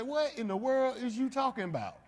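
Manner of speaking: high-pitched mock bafflement; baffled reaction. Listener answering old-fashioned English, baffled. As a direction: act out a confused listener, pitched right up.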